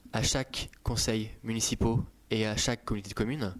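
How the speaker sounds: ordinary talk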